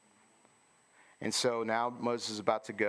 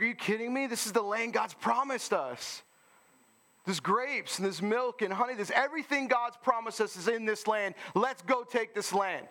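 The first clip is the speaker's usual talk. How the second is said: high, fired-up mock exhortation; rally them like Caleb; 12 semitones higher than usual. Caleb shutting up the crowd, high fired-up. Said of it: "He's like".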